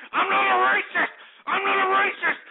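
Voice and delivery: shouting, voice cracking in outrage; angry shout. Acted. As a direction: yell the denial, near tears. Ranting host denies being racist.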